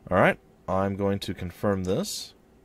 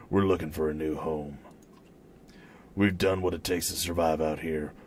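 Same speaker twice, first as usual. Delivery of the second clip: low, subdued man's voice; hooded stranger; 4 semitones lower, 3 dB quieter than usual. Acted. Hooded man introducing himself to the Ravens, low stranger's voice. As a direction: speak low as a reserved traveler.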